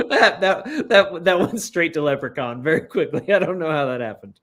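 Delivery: talking through laughter, high then settling; amused laughter. Real, not acted. Player amused at the accidental leprechaun voice, laughing as they talk. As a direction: laugh at your own silly voice.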